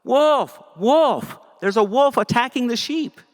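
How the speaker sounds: put-on shepherd boy fooling the village a second time, yelling high; yells out. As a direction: holler wolf again to trick the villagers. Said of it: "he yells out".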